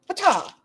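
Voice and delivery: sudden high "pata" burst; imitated kick noise. Trapped Emily kicks out at Ambi, imitated impact. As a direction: pop it out like a kick landing.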